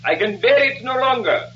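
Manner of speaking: high-pitched, impassioned stage lover; melodramatic pleading. Acted. Colonel begs his childhood love in rehearsal, melodramatic pleading. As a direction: plead like an overwrought stage suitor.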